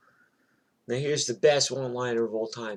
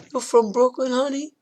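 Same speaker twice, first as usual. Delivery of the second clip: very high, louder mock question; incredulous question; 12 semitones higher, 5 dB louder than usual. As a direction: replay the one-liner's setup, voice way up. Replaying the neighbor's one-liner.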